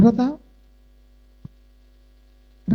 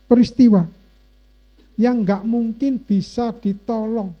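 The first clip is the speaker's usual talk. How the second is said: ordinary delivery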